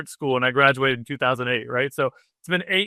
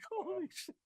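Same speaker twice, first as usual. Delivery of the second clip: a stunned, near-breathless "Holy shit", much softer; stunned hush; 20 dB quieter than usual. Retold or imitated. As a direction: let it slip out in shocked disbelief. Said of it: "And I just lost it".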